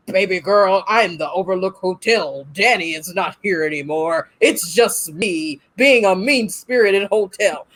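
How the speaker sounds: low, menacing hotel spirit; evil hotel voice. Retold or imitated. Possessed Danny taunting the girl, low and menacing. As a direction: drop it low and gloat like a haunted building.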